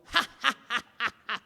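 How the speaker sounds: evenly spaced, stiff ha-ha-ha. Retold or imitated. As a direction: imitate a crowd's laugh in separate beats.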